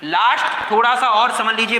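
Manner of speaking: ordinary talk, nothing marked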